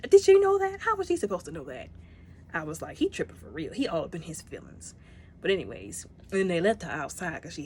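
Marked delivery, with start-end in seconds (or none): 0.1-1.9 much higher and louder, exasperated; indignant outburst. Real, not acted. Viewer defending Nebula, an exasperated high cry. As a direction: shoot it up in outrage.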